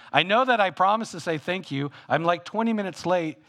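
high, loud rushed apology; tossed off like an afterthought; sheepish late thanks. Retold or imitated. Preacher voicing his late thanks to God, high and loud. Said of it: "I think".

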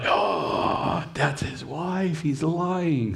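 catching someone in a lie; lightly voiced king catching the lie; mock realization. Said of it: "And he's like".

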